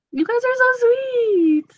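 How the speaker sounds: high, slow and sing-song; sweet, drawn-out gush; gushing thanks. Real, not acted. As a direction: coo it to the viewers. Touched by viewer comments.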